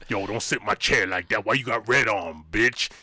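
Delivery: low, tough street ghost voice; gangster ghost. Pretend. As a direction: talk like a hard old-school gangbanger ghost.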